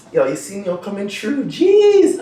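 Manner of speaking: show-off swagger ending in a squeal up high; feeling yourself. Pretend. Dancer shows the feeling of the reach, swaggering show-off. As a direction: strut it like you know everyone's watching. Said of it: "this is that moment you feel like".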